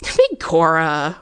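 a slow, drawn-out Irish exclamation; exclaimed. A narrator's reading. McGuire reacting to the frightened student, exclaiming slowly. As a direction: let the oath stretch out in surprise. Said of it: "McGuire exclaimed".

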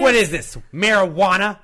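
mock parent.

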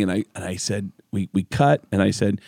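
usual speaking voice

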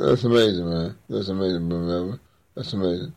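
usual speaking voice

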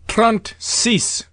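high, ringing French call; croupier crying out. A narrator's reading. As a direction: call the number out across the table. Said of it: "when the croupier cried out".

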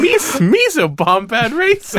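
high, slow laughing Gungan voice. Pretend. Joking among friends in a high Gungan voice. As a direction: squeak it like Jar Jar through a laugh.

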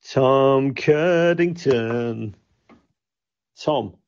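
long, drawn-out name call; calling out slowly. Real, not acted. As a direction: stretch each syllable to summon the missing caller. Calling for silent, muted caller.

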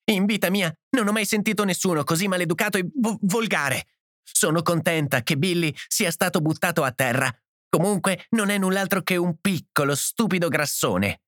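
high-pitched, upset tirade; tearful outrage. A narrator's reading. Edith insulting the hosts after bursting into tears, sobbing outrage. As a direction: hurl insults as if through sobs. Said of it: "singhiozzò".

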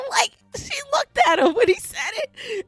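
high, laughing through the words. Real, not acted. Telling her friend about the coworker's look, laughing. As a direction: giggle it out.

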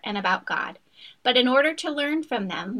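ordinary talk

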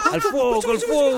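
very high, loud fire alarm cry; hammed-up shriek of alarm; panicked scream. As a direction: scream for help as flames bite. Victim screams fire in the sketch.

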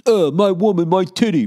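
mock the wounded male ego; mock caveman ego. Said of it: "is most of this about your ego".

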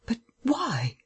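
high, bewildered question, building in force; utter amazement. A narrator's reading. Man questions the woman who saved him, utterly amazed. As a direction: ask in sheer disbelief, pitched high. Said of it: "in utter amazement".

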